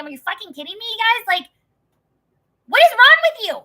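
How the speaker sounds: incredulous, rising to a loud high pitch; retold exasperation boiling over; exasperated outburst. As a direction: build from disbelief into an outraged yell. Acted outraged question to her relatives.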